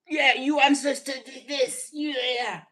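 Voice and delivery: high, loud mock accusation; imitating an angry accuser, pitched well up.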